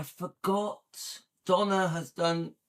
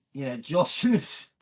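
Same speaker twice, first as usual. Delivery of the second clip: ordinary talk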